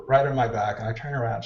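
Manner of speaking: ordinary talk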